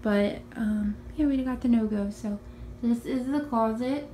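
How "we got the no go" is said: ordinary delivery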